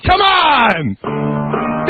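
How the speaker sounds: usual speaking voice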